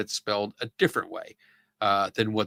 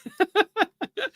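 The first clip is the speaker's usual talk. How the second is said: high laugh. Real, not acted. Laughing at pronunciation.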